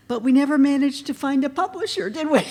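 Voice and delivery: high, loud, fast laughing jab; giggling aside to a friend in the audience; laughing tease. Real, not acted. Teasing her friend on stage.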